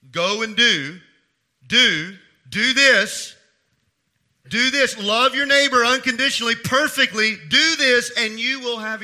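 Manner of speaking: full-force preaching. Acted. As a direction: proclaim it to the whole church.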